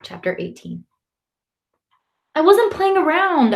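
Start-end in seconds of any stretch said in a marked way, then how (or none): 2.3-3.5 high-pitched, indignant denial; protesting. A narrator's reading. Boy accused of playing around, protesting. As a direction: defend yourself against blame. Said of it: "I protest".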